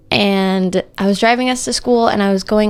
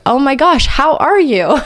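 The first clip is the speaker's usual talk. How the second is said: mock surprised concern; 6 semitones higher, 4 dB louder than usual. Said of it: "his first words were".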